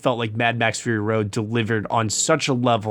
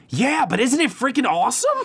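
high-pitched, gushing retort; fan's delighted protest, pitched way up; excited comeback; 12 semitones higher than usual. Retold or imitated. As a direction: throw it back at the naysayers with glee. Acting out excited comeback to critics.

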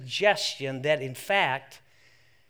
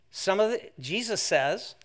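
ordinary talk, nothing marked

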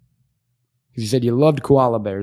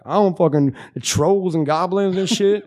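impression of stoned Jesse; 3 semitones higher than usual. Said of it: "you do a wonderful impression of me".

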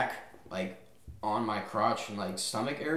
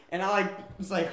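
usual speaking voice

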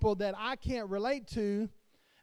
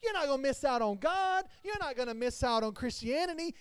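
normal talking voice

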